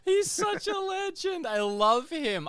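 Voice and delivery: loud, high, drawn-out delight; gushing affection. Real, not acted. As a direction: gush at full volume about a beloved friend. Gushing about friend after the call.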